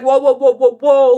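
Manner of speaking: loud calming whoa; calling whoa. Retold or imitated. Kenji restraining the furious girl with loud calming whoas. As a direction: hold her back with a loud whoa. Kenji holding her back.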